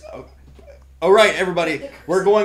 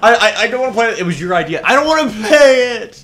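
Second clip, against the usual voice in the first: louder, higher whining complaint; loud protest; 8 semitones higher, 9 dB louder, faster than usual. Real, not acted. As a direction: protest it loudly, exasperated. Protesting loudly.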